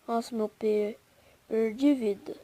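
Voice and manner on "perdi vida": normal talking voice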